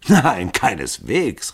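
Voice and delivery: slow, amused denial with a laugh in it; laughing reply. A narrator's reading. Hall laughingly denying to the assembled men. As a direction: brush it off laughing. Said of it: "rief Hall lachend".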